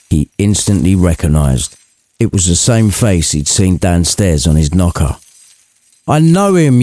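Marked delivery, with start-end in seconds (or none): 6.1-6.8 higher, eager contestant shout; game show yell. Acted. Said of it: "yelled Scrooge, as if he was on a game show".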